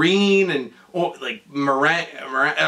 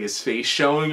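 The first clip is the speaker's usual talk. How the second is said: ordinary delivery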